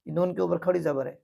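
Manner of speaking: ordinary talk, nothing marked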